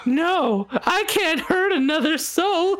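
very high, pleading dog with a laugh. Acted. The dog refusing to bite a man, high pleading voice. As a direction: whimper it out like a pure-hearted pup. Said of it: "ears flapping like".

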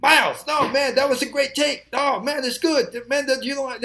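high-pitched, excited protest. Retold or imitated. Engineers arguing with [name] over the take, excited protest. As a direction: push back hard, pitch up high.

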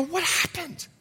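high, thin what happened asked in shock; frightened question. Retold or imitated. Preacher retelling asking his driver, frightened and high. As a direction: ask it like a man just come to in the dark. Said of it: "I started crying".

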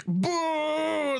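long, high-pitched boos; booing crowd. Acted. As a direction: draw out each boo high, like a jeering crowd. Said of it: "crowd just start booing".

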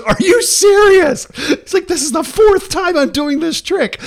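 very high, loud imitation of an outraged magician; hammed-up yell at a hopeless volunteer; exasperated shout. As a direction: shriek it in comic disbelief. Acted magician exasperated at failed timing.

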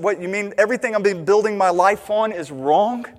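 rising to a loud outraged No way; protest, building to a shout; indignant protest. Retold or imitated. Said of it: "we begin realizing".

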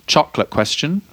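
normal talking voice

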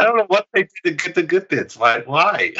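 playfully outraged at the buff; half-laughing why, why; mock disbelief. Real, not acted. Reacting to the Good Bits buff.

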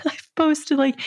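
ordinary delivery

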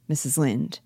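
normal talking voice

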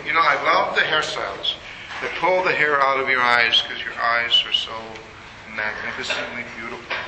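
low, soft, tender flattery; sweet-talking husband. Retold or imitated. Husband flattering his crying wife about her hair, tenderly. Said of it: "make up the biggest lie I can think of".

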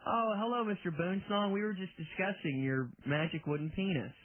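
ordinary talk, nothing marked

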